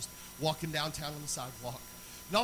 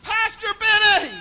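high, slow, drawn-out yell from a truck; retold scream of the pastor's name; 18 dB louder, slower than usual. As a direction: call him out loud across the street. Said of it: "started screaming".

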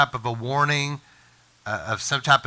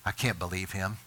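gossiping whisper; 3 semitones lower, 8 dB quieter than usual. Retold or imitated. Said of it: "If somebody comes to me and says".

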